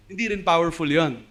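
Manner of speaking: ordinary talk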